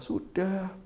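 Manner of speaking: soft, slow goading dare; soft taunt. Retold or imitated. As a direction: quietly dare him to strike. Istri menantang suami memukul. (menantang dengan suara pelan dan lambat)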